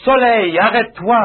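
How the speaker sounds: commandement fort et haut, sans crier; ordre au soleil. Retold or imitated. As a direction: ordonne au soleil avec autorité. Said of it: "Il avait un besoin et dit". (ordre puissant lancé au soleil)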